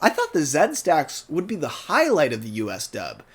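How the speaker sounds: usual speaking voice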